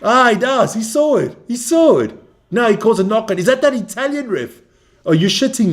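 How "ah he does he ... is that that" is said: excited reaction. Real, not acted. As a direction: burst out, pleased the ref saw it. Live reaction to referee call.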